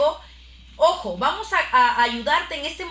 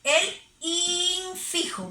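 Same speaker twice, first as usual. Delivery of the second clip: slow reveal; 3 semitones higher, much slower than usual. Shown as a demonstration. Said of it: "teacher revealing the answer".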